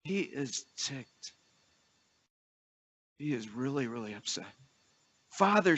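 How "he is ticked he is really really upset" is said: hushed intensity. Acted. Preacher quietly stressing the brother's anger, softly intense. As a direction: lean in, almost confiding the anger.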